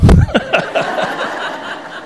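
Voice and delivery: high unvoiced burst of laughter; real burst of laughter. Real, not acted. Pastor laughing at his own joke, a real burst of laughter.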